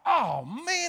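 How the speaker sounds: high, loud dismayed exclamation; dismayed oh man. Retold or imitated. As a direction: caught off guard by the kid. Kid interrupts the prayer.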